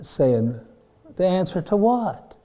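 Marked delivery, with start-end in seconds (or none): 1.2-2.3 mildly mocking, higher-pitched question; lightly imitated scoffing challenge; Garner Ted Armstrong. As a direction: make fun of it with a skeptical retort. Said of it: "making fun of him".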